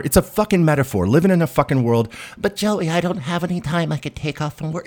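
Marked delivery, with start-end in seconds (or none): 2.4-4.9 mock whiny listener.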